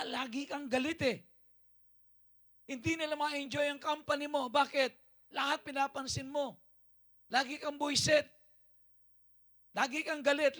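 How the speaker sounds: high, loud, driving sermon; fiery preaching. Acted. Pastor rebuking the congregation about anger, fiery preaching. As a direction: preach it hard to the crowd.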